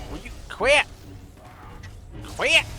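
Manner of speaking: high, loud protest rising on "quit"; exasperated yell. Real, not acted. Player beaten on by enemies, exasperated high yell. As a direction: yell at them to stop. Yelling at attackers who keep hitting.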